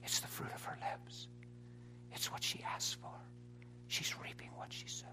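very soft, whispery imitation of the devil; accusing inner voice. Preacher voicing the spirit during his wife's seizure, accusing inner voice. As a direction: drop almost to a whisper as the tempter. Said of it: "his voice was saying".

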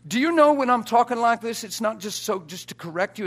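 high, loud, pleading insistence; insistent preaching. Acted. Preacher pressing the congregation to accept, loud and high. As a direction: make them believe you.

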